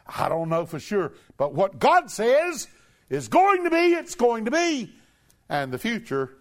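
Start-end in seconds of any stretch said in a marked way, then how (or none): 1.4-4.9 high, loud, hammering declaration; preaching shout. Real, not acted. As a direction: proclaim it as certain truth. Preacher proclaims God's word.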